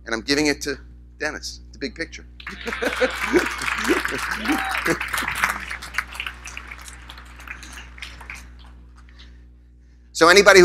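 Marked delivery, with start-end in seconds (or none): 2.3-8.4 breathless, airy laugh. Real, not acted. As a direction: laugh at your own story. Audience moment, speaker laughs.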